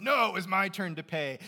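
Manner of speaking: the first guy won't let anyone else pay; mock protest. First guy at the register insisting on paying, mock protest. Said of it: "The first guy says".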